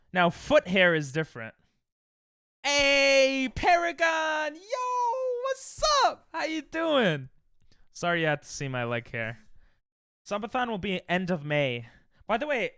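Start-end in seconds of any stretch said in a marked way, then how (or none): 2.6-7.3 loud, very high, drawn-out holler; whooping high-pitched shout of welcome; excited greeting. Real, not acted. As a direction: shriek a thrilled hello to a friend. Calls out to greet arriving viewer.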